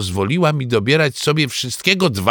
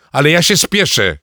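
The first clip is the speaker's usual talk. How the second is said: raised, higher hurried shout; dad yelling that he's in a rush; 5 semitones higher, 5 dB louder than usual. Acted. Father shouting at mother about hurrying. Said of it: "krzyknął tata".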